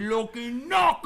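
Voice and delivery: put-on high, loud Cockney accent; hammy Cockney accent. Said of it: "back to our Cockney thing".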